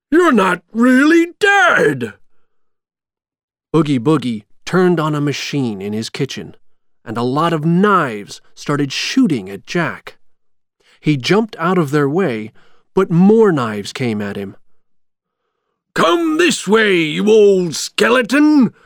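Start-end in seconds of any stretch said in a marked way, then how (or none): 0.1-2.1 high, loud, drawn-out disbelief; astonished shout. A narrator's reading. Villain to rival he thought dead, shocked shout. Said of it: "shouted Oogie Boogie". 15.9-18.7 high, loud, drawn-out villain's call; taunting shout. A narrator's reading. Villain luring Jack toward the knives, taunting shout. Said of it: "shouted Oogie Boogie".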